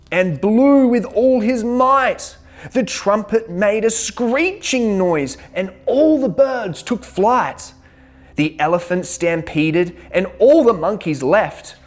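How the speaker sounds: excited. A narrator's reading. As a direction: tell it like a wild stampede. Dramatic trumpet chaos.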